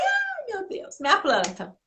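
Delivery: high shocked wail over the plant; dismayed cry. Retold or imitated. Mother cries out seeing her plant knocked over, high and dismayed. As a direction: gasp it out, horrified at the broken plant.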